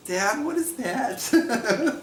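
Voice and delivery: put-on high, slow child voice asking Dad; drawn-out, high-pitched kid's question; puzzled kid. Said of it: "you might be, uh, be like".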